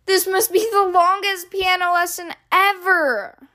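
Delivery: high whiny wail of frustration. A narrator's reading. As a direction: moan it out, sorely impatient. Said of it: "Henry wails".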